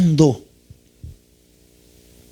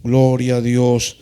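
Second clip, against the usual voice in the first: 1 mock rote praise; 7 semitones lower than usual. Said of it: "solo se la pasan diciendo".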